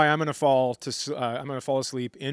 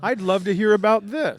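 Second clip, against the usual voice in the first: higher, louder mock eagerness; mock eager interest; 7 semitones higher, 6 dB louder than usual. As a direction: ham up fake fascination.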